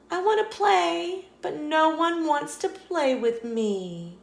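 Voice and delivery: high small squirrel voice, a touch sad; a little squirrel who wants a friend; lonely squirrel. Acted. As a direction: sound small and left out.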